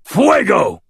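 high, loud battle cry; snarled incantation. A narrator's reading. Wizard firing his blasting rod at the creature, snarled shout. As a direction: hurl the spell word out in a shout. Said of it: "and with a snarled".

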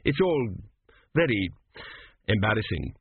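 halting, embarrassed admission. A narrator's reading. Prince explaining his parents to a friend, embarrassed. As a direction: trail out word by word, awkwardly.

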